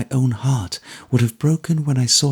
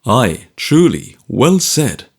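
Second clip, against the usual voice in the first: louder, hearty cry of approval; cried out; 5 dB louder, slower than usual. A narrator's reading. Guardian cheering Richard's resolve, hearty cry. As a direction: call it out warmly. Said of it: "cried my guardian".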